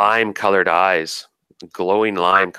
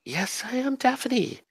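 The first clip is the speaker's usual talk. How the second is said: very high, slow and airy fairy voice; fey Daphne voice; 7 dB quieter, slower than usual. Acted. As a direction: speak like a delicate forest spirit, softly and slowly. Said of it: "she goes".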